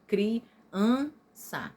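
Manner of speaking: CRIANÇA in three separate beats, cri, an, ça; split into syllables. Shown as a demonstration. Teacher showing grammatical division, CRIANÇA split into syllables. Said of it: "a gente aprendeu assim".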